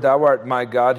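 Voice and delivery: ordinary talk, nothing marked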